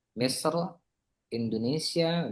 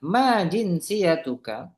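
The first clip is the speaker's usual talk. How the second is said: model question; 6 semitones higher, 7 dB louder, slower than usual. Shown as a demonstration. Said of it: "kita coba ya".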